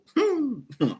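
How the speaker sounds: high disbelieving laugh. Real, not acted. Fan dreading the Liverpool game, bitter laugh. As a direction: laugh at how bad it will get.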